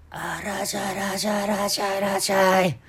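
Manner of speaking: put-on old market hawker, sing-song and drawn out; slow, sing-song market hawker voice; market vendor call. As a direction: call out to passers-by like an old stallkeeper. Old vendor greeting customer.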